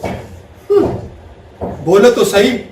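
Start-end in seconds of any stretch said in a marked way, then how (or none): 0.0-0.9 high sulky hum. Retold or imitated. Wife's sulky reply.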